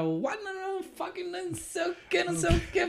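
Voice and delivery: high-pitched imitation of a ranting boss; mock angry rant.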